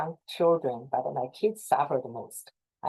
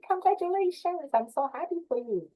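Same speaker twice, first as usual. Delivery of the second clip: much higher, gushing congratulations; excited congratulations; 12 semitones higher than usual. Retold or imitated. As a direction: congratulate a colleague with delight. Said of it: "and then say".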